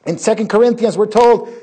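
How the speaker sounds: loud, high preaching build. Acted. Preacher loudly announcing scripture to the congregation.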